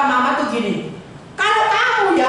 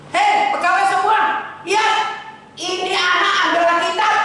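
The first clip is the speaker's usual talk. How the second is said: lightly raised, higher calling out to the staff; master calling out; 7 semitones higher than usual. Retold or imitated. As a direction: call the servants together. Master announces to servants. (majikan memanggil semua pegawai dengan suara tinggi)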